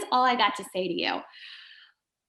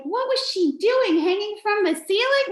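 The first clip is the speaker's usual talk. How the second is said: amazed; 9 semitones higher, 3 dB louder than usual. Retold or imitated. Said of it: "I just am like".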